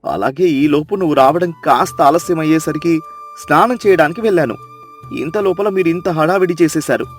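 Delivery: low, fast scolding husband. Acted. Chandranna scolding silly Sita before the crowd, low scolding. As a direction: tell her off for her foolishness. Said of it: "నాలుగు చివాట్లు పెడతాడు".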